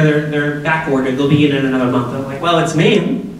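usual speaking voice

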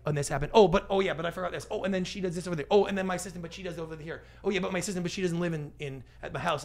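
scatterbrained rush of afterthoughts, each "oh" popping up; scattered, muddled telling. Shown as a demonstration. Storyteller mocking cluttered pitches, scattered and rambling. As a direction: ramble breathlessly from one correction into the next. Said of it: "clouding it".

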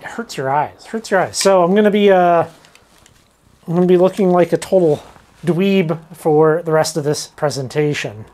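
ordinary talk

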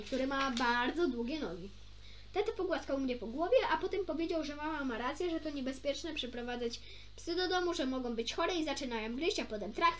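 ordinary delivery

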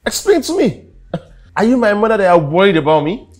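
high, loud and fast, mocking her worry; angry retort. Husband mocking his worried wife, angry retort. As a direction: throw her question back at her.

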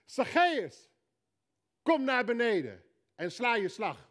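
loud call up into the tree; Jesus calling Zacchaeus down. Acted. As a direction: call his name out loud to a man in a tree.

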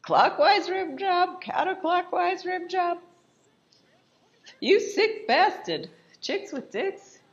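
high, slow, appalled read-out; scandalised mock disbelief. Friend reads out the laptop's porn, mock appalled. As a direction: read each find out in high-pitched horror. Acting out reading laptop's contents.

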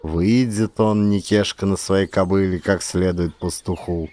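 ordinary delivery

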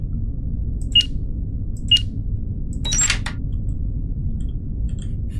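airy, silent laugh under the breath; a quick breathy chuckle. Real, not acted. Laughs while reading chat. (rire soufflé)

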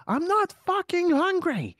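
mock British accent, pitched well up; fake British accent. Basement son refusing his mother's dinner call, in a fake British accent. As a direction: put on a bad British accent. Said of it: "trying to sound like he's British".

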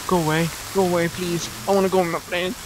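usual speaking voice

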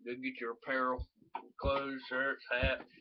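usual speaking voice

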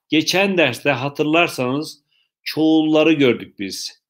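ordinary talk, nothing marked

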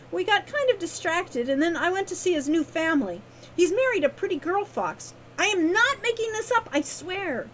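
high, eager, tumbling explanation; excitable young Wren. A narrator's reading. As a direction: swear it's true in a high girlish voice. Wren voiced in the story.

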